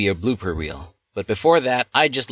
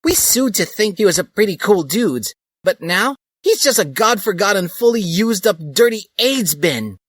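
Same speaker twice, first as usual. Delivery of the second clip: hateful rant; 12 semitones higher, 4 dB louder than usual. Acted. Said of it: "She was so full of hate".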